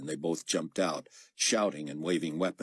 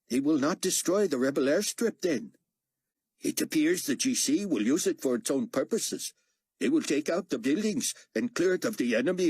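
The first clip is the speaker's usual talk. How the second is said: higher, lightly voiced steward; 9 semitones higher than usual. A narrator's reading. Steward talking to a colleague in the plane cabin, lightly voiced. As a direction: pitch it up for the gossiping steward. Stewards speaking, translated captions.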